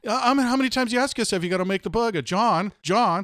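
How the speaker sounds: high, loud mock TV pitchman; infomercial pitchman. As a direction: imitate an over-eager British or Australian infomercial host. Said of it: "say the name so much".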